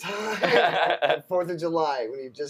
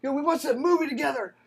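mock heartbroken drunk; 8 semitones higher than usual. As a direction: wail it like a betrayed brother. Mocking drunk sentimental texts again.

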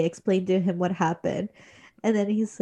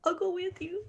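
higher and much softer; tender reassurance; 10 semitones higher, 8 dB quieter than usual. Retold or imitated. Sanemi comforting his mom, soft and tender. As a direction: gently promise to go along. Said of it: "he's like".

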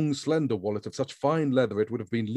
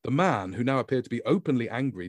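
usual speaking voice

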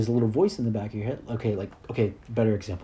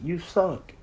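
higher, slow mock yell of a nasty inner voice; 6 semitones higher, slower than usual. Anxiety's inner voice insulting him, in a mock yell. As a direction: sneer it like the voice of anxiety, pitched up. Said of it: "it's yelling at you constantly".

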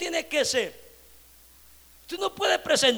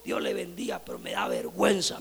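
tearful whisper; 8 semitones lower, 8 dB quieter than usual. Retold or imitated. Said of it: "se le vinieron las lágrimas".

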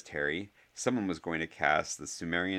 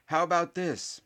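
high, projected announcement; loud; 12 semitones higher, 5 dB louder than usual. A narrator's reading. Harry proposes terms to everyone, loudly. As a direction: raise the voice for a crowd. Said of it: "Harry said loudly".

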